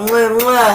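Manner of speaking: two loud smacking air kisses; between kisses. Real, not acted. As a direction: blow two kisses at the camera. Blowing kisses to Deborah.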